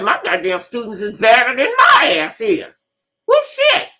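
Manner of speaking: proud outburst. Real, not acted. Proud outburst about students.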